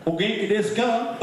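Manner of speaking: put-on high Southern-accented Japanese. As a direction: greet them in Japanese with a Southern twang. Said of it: "Japanese with a Southern accent".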